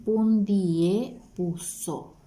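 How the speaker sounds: slow, syllable by syllable pundie puso; teacher modelling "pundie puso" slowly; slow model word. Shown as a demonstration. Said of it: "Ñamétahína guaraní".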